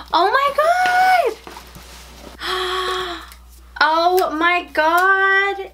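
high shrieking gasp into a very slow oh my God; shrieking surprise. Real, not acted. Pulling out the Haunted Mansion item, she shrieks in surprise. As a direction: lose it with delight. Second item revealed, cry.

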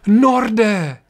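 high, loud joyous cry; joyful call. Acted. William greeting his master Nord, joyful loud call. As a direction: call out his name in relief. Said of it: "radostně zavolal".

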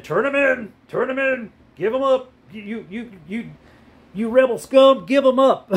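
high-pitched caricature of a demanding governor; mock governor. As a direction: imitate a politician's order, pitched well up, spluttering midway. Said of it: "the governor said".

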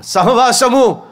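fervent preaching. Acted. Preacher drives home the point.

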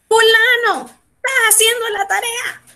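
put-on high-pitched call to a kid about homework; parent calling child. Mother asks fulanito about the homework, calling in a high voice.

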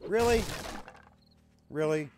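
jolted disbelief; surprised really. Real, not acted. Shocked reaction in game.

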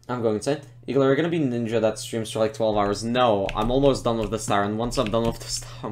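ordinary talk

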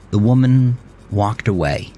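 ordinary delivery, nothing marked

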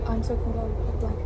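ordinary talk, nothing marked

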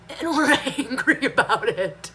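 half-laughing indignation; laughing anger. Real, not acted. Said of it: "so angry".

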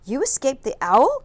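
loud, high amazed cry. A narrator's reading. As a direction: cry out in joyful disbelief. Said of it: "cried Stellaluna".

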